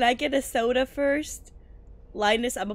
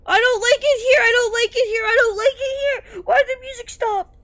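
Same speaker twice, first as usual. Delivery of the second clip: much higher, louder, near-tearful wailing; panicked wail; 10 semitones higher, 5 dB louder than usual. Real, not acted. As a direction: cry out in real fright. Panics in horror game.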